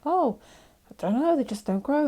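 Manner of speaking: high, breezy fake innocence; innocent denial. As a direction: play it off all innocent. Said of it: "And I was like".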